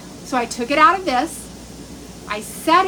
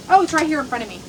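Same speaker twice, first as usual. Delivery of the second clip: ordinary talk, nothing marked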